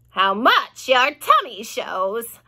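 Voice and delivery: slow, sneering mockery; a hint of a spiteful aunt; mocking aunt. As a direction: jeer at her sister slowly. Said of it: "Aunt Spiker cried".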